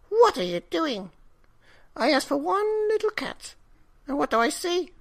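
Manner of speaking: put-on very high, loud, alarmed old woman; dismayed old woman. A narrator's reading. Old wife scolds husband about the cats, high dismay. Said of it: "she cried".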